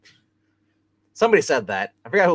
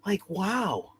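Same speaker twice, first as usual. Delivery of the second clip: airy drawn-out wow; lightly voiced surfer drawl; Owen Wilson impression; 9 semitones higher, 3 dB quieter, much slower than usual. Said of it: "Owen Wilson".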